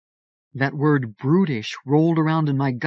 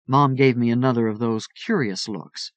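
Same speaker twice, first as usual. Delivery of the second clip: ordinary delivery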